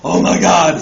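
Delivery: loud mock-mortified exclamation; embarrassed outcry. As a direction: cry out at your own embarrassment. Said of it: "I was so embarrassed".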